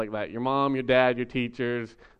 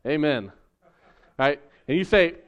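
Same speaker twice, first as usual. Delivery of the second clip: usual speaking voice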